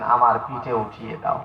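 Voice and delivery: low, soft request; understated, low-pitched command; subdued order. Acted. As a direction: say it low and quiet, almost in passing. First, low take of the order.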